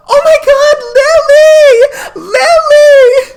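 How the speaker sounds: very high, loud shriek on the edge of laughter; fan's scream. Retold or imitated. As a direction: imitate a starstruck fan shrieking. Fan screaming at star, teller laughing.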